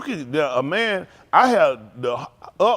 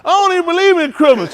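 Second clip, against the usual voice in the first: much higher, louder put-on voice of a fed-up man; mock complaining man; 13 semitones higher, 9 dB louder than usual. Man asking why all the Christmas songs, high mock whine. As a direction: squeak it out as an exasperated guy. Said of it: "That man told us".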